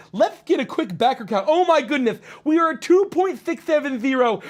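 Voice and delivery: high, loud, lisping goofy voice; excited goofy character. Acted. Streamer hypes campaign milestone to viewers, excited goofy character. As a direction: crow the big news in a goofy lisp.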